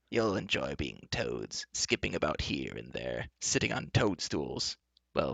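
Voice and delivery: low, soft, leisurely enchantress; whimsical enchantress. Acted. Enchantress selling toad life to captives, whimsical. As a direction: muse softly, as if charmed by the idea.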